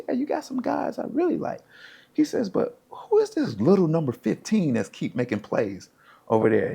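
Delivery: high-pitched retold scout voice; imitated scout. Scout asking coach about little number fifteen, high voice. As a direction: pitch it way up, curious and impressed.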